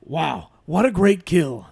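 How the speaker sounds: drawn-out Pacino impression. Shown as a demonstration. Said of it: "read them out loud in the voice".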